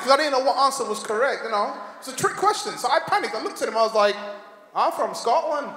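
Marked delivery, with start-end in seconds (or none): 4.7-5.7 put-on Scottish accent, raised pitch; hastily faked Scottish accent. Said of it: "with a Scottish accent".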